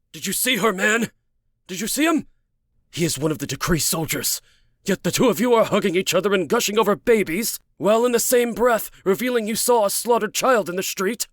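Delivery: high, incredulous interrogation; furious tirade. Acted. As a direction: rail at her in disbelief. Man keeps raging at her.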